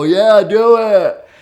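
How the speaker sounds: loud, high, drawn-out cheer; high school crowd. Retold or imitated. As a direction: imitate a whole student crowd egging someone on. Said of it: "the entire high school will go".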